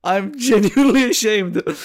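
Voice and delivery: slow, drawn-out apology, lightly acted; retold mortified apology. Trainee apologising to the father after the mix-up, slowly mortified. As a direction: stretch out the shame, half-laughing.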